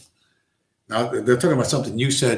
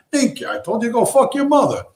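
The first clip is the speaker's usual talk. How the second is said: much higher, cocky wiseguy punchline; gangster comeback; 11 semitones higher than usual. Retold or imitated. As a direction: deliver the insult with mob swagger. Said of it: "And he says".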